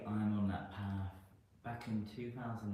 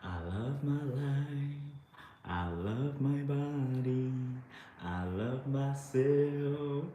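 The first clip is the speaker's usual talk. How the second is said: slow, loud, drawn-out affirmation chant; 5 semitones higher, 7 dB louder, much slower than usual. Acted. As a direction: declare each line slowly like a mantra.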